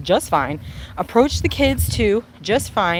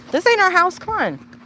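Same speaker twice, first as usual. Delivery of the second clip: high, louder call to the dog; talking to the dog; 12 semitones higher, 3 dB louder than usual. Real, not acted. Redirecting the dog at the wrong house, high voice. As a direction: pitch it way up to steer the pet.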